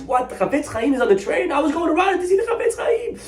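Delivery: high, fast astonished cry with a laugh; retold man's shouted amazement; shocked disbelief. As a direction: blurt it out, stunned. Man learns rabbi is aboard.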